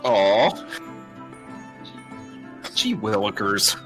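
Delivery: ordinary delivery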